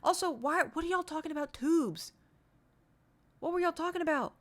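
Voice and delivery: puzzled, high and loud; baffled; confused. Real, not acted. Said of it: "I was so confused".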